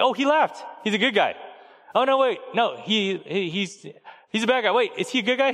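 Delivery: put-on flip-flop eagerly like a small child; little daughter.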